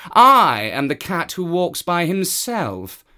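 high, loud proud cat; a cat who answers to no one; haughty cat. Acted. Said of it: "said the cat".

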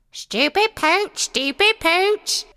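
high squawking parrot voice. A narrator's reading. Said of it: "The parrot started repeating".

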